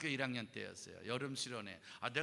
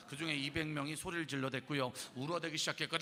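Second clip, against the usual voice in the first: ordinary talk, nothing marked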